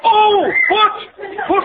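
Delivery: high-pitched startled outburst; flustered exclamation. Acted. Husband learning it was wife's foot asleep, flustered.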